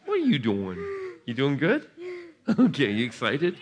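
ordinary delivery

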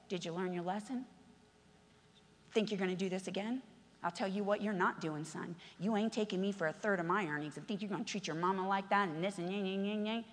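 a low, quiet scold of the prodigal son; mock scolding elder. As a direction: grumble down at him like a stern church mom. Preacher voices scolding church member.

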